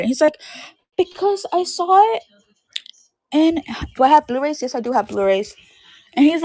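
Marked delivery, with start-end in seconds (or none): 1.0-2.2 high-pitched imitation of a frustrated little cousin; frustrated kid. Little cousin protesting to older relative about the movie, frustrated. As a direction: mimic a frustrated child, pitched well up. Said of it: "freaking frustrated about it".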